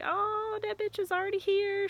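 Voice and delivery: very high-pitched, singsong retold reaction; delighted exclamation. Spotting the cardinal in front of the car, delighted.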